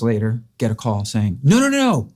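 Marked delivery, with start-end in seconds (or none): 1.4-2.1 much higher, rushing to undo the bad news; blurting out that it was a mistake; hurried correction. Caller quickly correcting the false news, hurried and pitched up.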